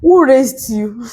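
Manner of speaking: slow, incredulous challenge; pointed question. Retold or imitated. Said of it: "at the point I was asking him".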